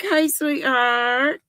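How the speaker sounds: ordinary delivery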